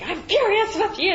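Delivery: high, loud mock of an angry dad; imitating dad's furious yell. Retelling how dad yelled at her, high loud mock fury. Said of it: "he was like".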